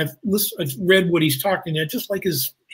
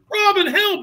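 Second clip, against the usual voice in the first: very high damsel-in-distress cry; mock cry for rescue in falsetto; Mary Elizabeth Mastrantonio. Dubbed Maid Marian dragged off by the Sheriff, high mock cry. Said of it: "they dubbed Mary Elizabeth going".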